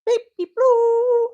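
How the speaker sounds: very high, slow sing-song name; calling the cat sweetly, very high, slow. Real, not acted. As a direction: coo the cat's name up high.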